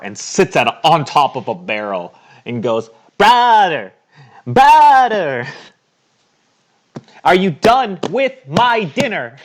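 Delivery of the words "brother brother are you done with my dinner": high, loud little-creature call; calling out as the Kenku. Acted. GM voicing the Kenku calling to his brother from the bar, high and loud. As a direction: shout it across the bar like a small bird-kid.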